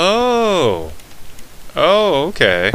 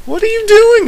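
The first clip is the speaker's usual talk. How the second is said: incredulous shout; 5 dB louder than usual. Real, not acted. Yelling at Billy character.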